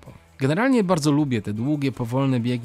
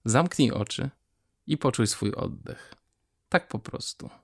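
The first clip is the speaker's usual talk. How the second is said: guided meditation. Acted. Short guided breathing exercise. (spokojny głos medytacji, coraz ciszej)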